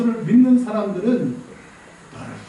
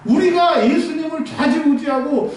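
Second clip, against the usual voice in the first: raised, high, fervent sermon voice; preaching at full force; 7 semitones higher than usual. Acted. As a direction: press the point home to the congregation. (열정적으로 설교하듯)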